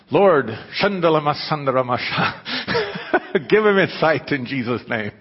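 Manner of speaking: mock charismatic healer praying over a blind man; mock tongues prayer. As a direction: parody a healing preacher babbling. Mocking tongues-speaking healers.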